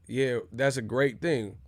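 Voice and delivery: ordinary talk, nothing marked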